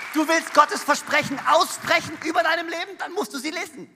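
loud, driving sermon voice; rising to a loud, fervent appeal; preaching at full force. Acted. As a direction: preach it out to the whole hall.

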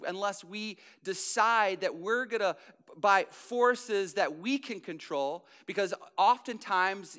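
loud, driving sermon voice; a pastor pressing the point home; preaching. Acted. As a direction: preach with rising force, leaning on key words. Full preaching delivery.

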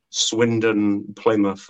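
ordinary delivery

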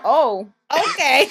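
a stunned "Oh, okay" broken by a laugh, slow; laughing disbelief. Retold or imitated. Worker laughing at the rude customer's outburst. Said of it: "and I'm like".

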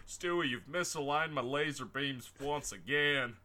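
lightly voiced cartoon dog; Brian the dog voice. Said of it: "I'm Brian the dog".

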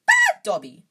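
piercing falsetto elf shout. A narrator's reading. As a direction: shriek self-blame very high and loud.

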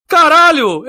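loud surprised outburst; surprised exclamation. Real, not acted. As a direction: blurt it out in shock. Surprised exclamation reading chat message.

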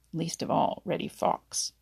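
ordinary delivery

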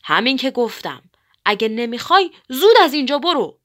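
loud angry command; shouting with all his might. A narrator's reading. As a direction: yell the threat with full force. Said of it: "با تمام نیروش داد زد".

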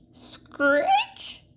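screechy high effect voice; spooky screech. Acted. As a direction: squeal it like scraping. Sound effect in story.

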